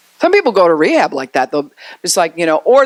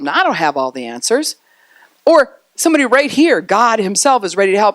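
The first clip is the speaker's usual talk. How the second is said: usual speaking voice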